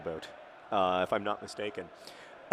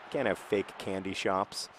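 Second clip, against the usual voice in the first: ordinary talk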